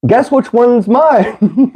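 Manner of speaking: high, drawn out with laughter; cracking up while asking; laughing. Real, not acted. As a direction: laugh your way through the question. Laughing over whose food is whose.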